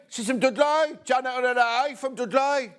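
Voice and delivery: high, hammed-up Dudley accent. Presenter imitating people from Dudley, hammed-up Dudley accent. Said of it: "somebody doesn't speak like that".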